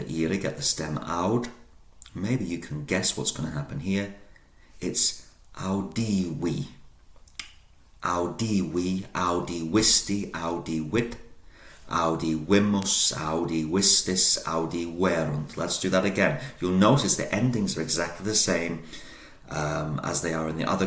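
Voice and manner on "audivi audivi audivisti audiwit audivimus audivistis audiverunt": audivi with the V said as a W, slow and deliberate; Latin V as W. Shown as a demonstration. Teacher drilling verb endings, V spoken as W. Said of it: "the V is pronounced as a W".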